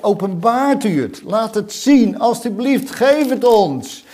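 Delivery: loud, high pleading; crying out. Acted. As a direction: implore God, voice lifted. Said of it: "Dat roepen ze uit".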